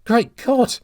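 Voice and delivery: high, loud gasp of recognition; shocked exclamation. A narrator's reading. Old man recognising his enemy, shocked high cry. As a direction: recoil in horror. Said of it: "exclaimed the old man, recoiling".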